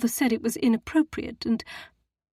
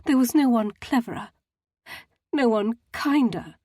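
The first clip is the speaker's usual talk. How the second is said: sorrowful; 5 semitones higher, 5 dB louder than usual. A narrator's reading. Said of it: "She sniffed sorrowfully".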